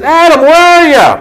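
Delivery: calling out. Retold or imitated. God calling Adam in the garden, loud call. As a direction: call for someone hidden. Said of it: "And the Lord God said".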